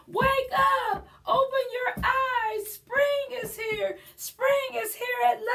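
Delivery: put-on high, eager kid voices; excited children. Children waking their parents, high excited calls. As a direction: shout it like kids on the parents' bed.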